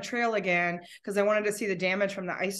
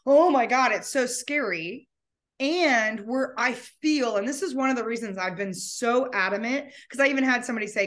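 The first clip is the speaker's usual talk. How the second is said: usual speaking voice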